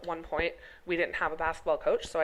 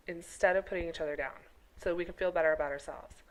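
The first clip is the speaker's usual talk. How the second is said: ordinary talk, nothing marked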